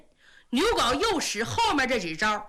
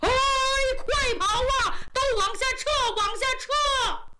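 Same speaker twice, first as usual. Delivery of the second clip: yelling retreat; 9 semitones higher than usual. Acted. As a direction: bellow the order to fall back. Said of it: "一边跑一边喊".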